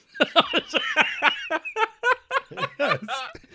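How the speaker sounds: shrieking high laugh; horrible laugh. Real, not acted. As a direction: let out a long squealing laugh. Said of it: "my horrible laugh out there".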